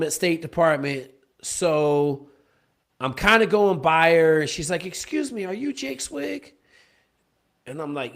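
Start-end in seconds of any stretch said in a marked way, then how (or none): 4.9-6.5 put-on timid young woman. Candidate Kim approaches him in lobby.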